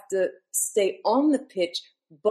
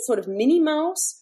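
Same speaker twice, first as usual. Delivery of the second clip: usual speaking voice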